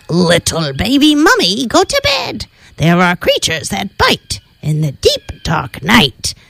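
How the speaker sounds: lightly voiced spooky skeleton; a hint of a creepy warning creature. Skeleton warns baby mummy.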